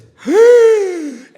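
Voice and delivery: high, loud theatrical gasp of a mother; a big dramatic intake of breath; shocked gasp. Retold or imitated. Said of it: "she goes like this".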